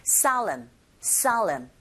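"Solemn" as SAH-lum, stressed first syllable; O close to AH. Shown as a demonstration. Teacher shows learners the sound, "Solemn" with o as ah. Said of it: "오보다는 아에 가깝게".

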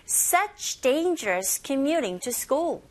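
slow, evenly stretched English phrase; slow pronunciation model. Shown as a demonstration. Teacher leads repeat-after-me practice, slow and drawn out. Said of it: "한 번 더 해볼까요".

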